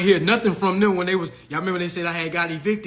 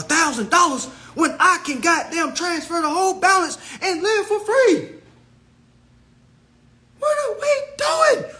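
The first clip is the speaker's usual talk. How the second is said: exasperated rant; 10 semitones higher than usual. Real, not acted. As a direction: rant it up to a yell. Ranting at followers about debt.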